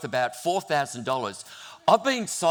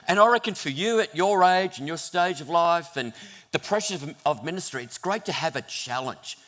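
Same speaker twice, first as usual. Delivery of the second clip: ordinary talk, nothing marked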